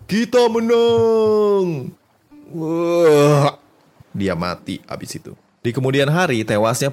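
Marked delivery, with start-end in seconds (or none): 0.1-1.9 high, long-drawn victory yell; triumphant shout. Acted. Soldier announcing victory after the marathon run, triumphant shout. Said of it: "Dan dia teriak". 2.5-3.6 loud, high death groan; dying groan. Acted. Soldier dies right after his victory cry, dying groan.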